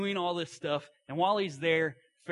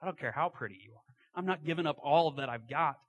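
loud, boastful emphasis easing off; a hint of pride; 3 semitones higher, 5 dB louder than usual. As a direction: list your achievements with some pride, punching them. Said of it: "there's a hint of pride".

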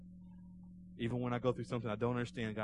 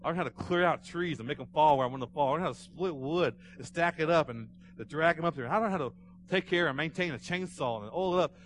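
raised, emphatic testimony; impassioned preaching; 4 semitones higher, 7 dB louder than usual. Retold or imitated. Preacher retelling a man's gratitude for hard work, impassioned. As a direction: drive home each hard-won lesson.

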